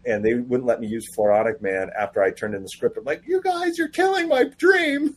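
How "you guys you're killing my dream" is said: very high, hammed-up whine; playful wail of protest in a squeaky pitch; mock anguish. Writer protests editors banning Floronic Man, mock anguished.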